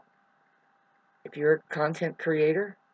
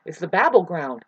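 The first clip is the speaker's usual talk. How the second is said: ordinary talk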